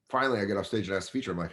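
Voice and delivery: usual speaking voice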